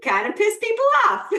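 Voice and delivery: high, giggling aside; amused, giggly quip; laughing. Real, not acted. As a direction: laugh the words out. Joking about rudeness, laughing.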